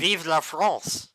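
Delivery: slow, hammed-up French accent. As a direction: proclaim it in fake French. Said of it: "the French accent".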